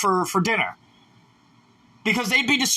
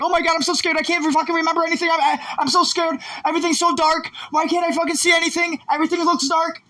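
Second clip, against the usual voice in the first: higher, a hint of mock panic; lightly voiced fright; panicked inner voice; 6 semitones higher, faster than usual. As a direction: act out the scared mind, pitched up. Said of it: "It's like".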